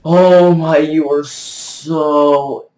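high, loud exasperation, stretching the last words; exasperated rant. Real, not acted. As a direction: rant at the opponent, voice pushed high. Ranting at a dancing opponent.

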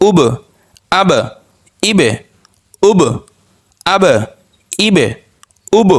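উব, আব, ইব each closing on a bounced ব; qalqalah bounce. Shown as a demonstration. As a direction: give every final ব a small echoing jolt. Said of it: "একটু ধাক্কা দিয়ে".

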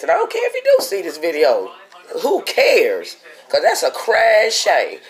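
indignant venting. Real, not acted. Sick worker venting about having to work, indignant.

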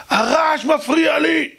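high, drawn-out complaining yell; shouting neighbour. Retold or imitated. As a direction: wail the complaint at full pitch. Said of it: "אבל למה אתה צועק". (צעקת תלונה גבוהה ונמתחת)

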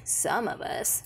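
throaty unvoiced whisper; old woman whispering. A narrator's reading. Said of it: "whispering in a throaty sort of voice".

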